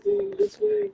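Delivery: very high, loud cry; high-pitched yell over the chaos; panicked shout. Acted. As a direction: shriek it as the crowd flees. Game master voices fleeing crowd.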